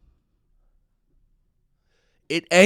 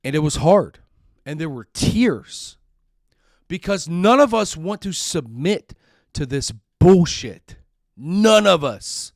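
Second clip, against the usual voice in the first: emotional outburst; 6 semitones higher, 7 dB louder than usual. Real, not acted. Tearful outburst over losing business.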